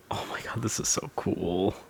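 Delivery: hushed slow whisper of awe; awed whisper. Real, not acted. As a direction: whisper it slowly in wonder. Awestruck at the setup.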